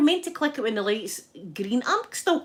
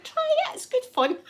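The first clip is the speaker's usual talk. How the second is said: much higher, eager wheedling; coaxing. Retold or imitated. She coaxes her ex-husband to try the gas and air, high and eager. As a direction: egg him on, pitched way up.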